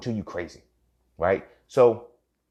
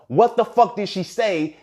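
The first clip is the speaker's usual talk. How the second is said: slow, high, loud questioning; exasperated demand; 12 semitones higher, 6 dB louder, slower than usual. Retold or imitated. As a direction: demand it word by word, voice raised. Acted demand for an answer.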